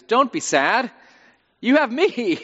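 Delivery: high, loud, clueless cheering-up; hammed-up husband offering himself as the cure; well-meaning husband. Husband consoling his sad wife, cluelessly upbeat. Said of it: "He means well".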